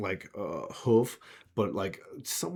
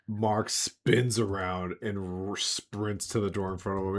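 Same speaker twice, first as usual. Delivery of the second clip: ordinary talk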